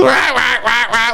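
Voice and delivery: frantic duck quacks; mimicked squawking duck; imitated duck quacking. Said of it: "all you hear coming down the road".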